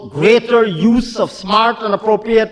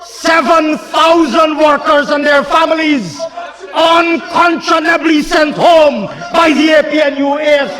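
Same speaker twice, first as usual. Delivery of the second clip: fiery oratory; 6 semitones higher than usual. Acted. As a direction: rail at the opposition benches.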